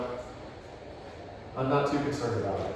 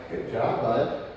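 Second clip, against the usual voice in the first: ordinary talk, nothing marked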